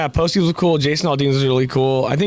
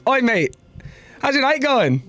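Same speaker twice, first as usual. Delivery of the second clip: higher-pitched imitation of an Aussie greeting; Australian accent; 12 semitones higher than usual. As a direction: greet them like a matey Australian. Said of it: "this Aussie dude comes up".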